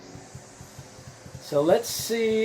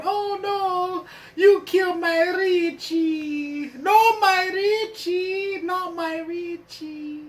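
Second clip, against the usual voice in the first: much higher, slow, anguished mother's wail; wailing mama; 14 semitones higher, 4 dB louder, slower than usual. Acted. Mama wailing over her dead Richie, high and slow. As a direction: cry out like a heartbroken mother over her son. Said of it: "poor Richie, mama, back there going".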